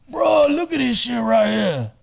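a retold shout in a put-on voice; imitated excited shout. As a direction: call your buddy over to look, loud. Mikey shows him the phone.

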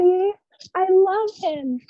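put-on high, slow, swooning girl voice; mock lovestruck daughter. Mocking the daughter sighing over her boyfriend, swooning.

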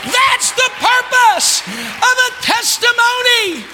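high, loud, shouting preacher; preaching at full force. Real, not acted. Pastor shouting promises to the congregation, full force. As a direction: build it to a roaring climax.

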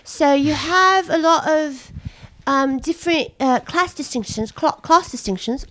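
chatting in a put-on Essex accent.

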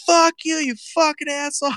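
shrill mock rage at her husband; high-pitched retold tirade; wife yelling.

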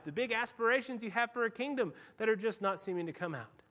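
usual speaking voice

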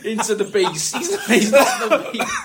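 high laughing rant. Real, not acted. Mate mocking the Beast actor, laughing high. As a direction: laugh through the put-down.

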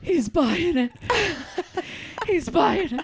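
high, loud, giddy whisper-cheer breaking into laughter; thrilled kid. Retold or imitated. Six-year-old showing off to Tuggy, high giddy laughing glee. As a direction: squeal it like a kid whose bluff worked. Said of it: "And I went".

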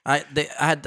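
ordinary delivery

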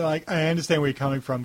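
ordinary talk

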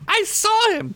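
put-on very high, insistent child voice; defiant little girl. A narrator's reading. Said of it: "Tina said defiantly".

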